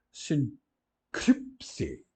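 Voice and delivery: "sincrípse" read slowly as sun-KRIP-se; Greek word read aloud. Shown as a demonstration. Teacher models "sincrípse" for the class, slow and careful. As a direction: say "sincrípse" syllable by syllable, Greek style. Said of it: "na quinta linha ou na quarta linha".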